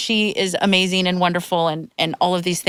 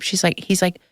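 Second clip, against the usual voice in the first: ordinary talk, nothing marked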